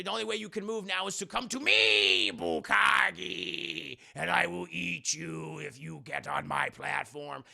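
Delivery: drawn-out menacing villain voice; villain taunt. Retold or imitated. Villain luring the hero onto his platform, drawn-out menace. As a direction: stretch the threat out slowly like a cartoon monster.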